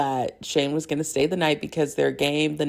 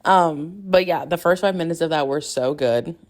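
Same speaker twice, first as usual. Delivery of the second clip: ordinary talk, nothing marked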